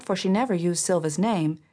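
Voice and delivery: ordinary delivery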